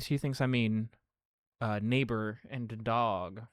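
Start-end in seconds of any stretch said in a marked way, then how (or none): none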